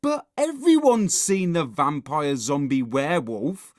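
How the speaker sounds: loud, whiny complaint; Horrid Henry moaning. A narrator's reading. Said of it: "moaned Horrid Henry".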